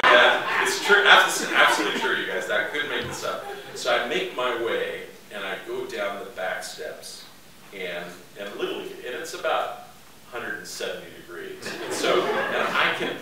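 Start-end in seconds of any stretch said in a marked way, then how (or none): none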